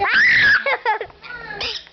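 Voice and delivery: loud laugh. Real, not acted. Dog reaction.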